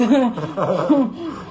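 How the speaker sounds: giggling at own joke; amused laugh. Real, not acted. Jokes about giving birth live.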